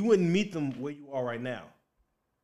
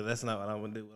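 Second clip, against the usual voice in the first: normal talking voice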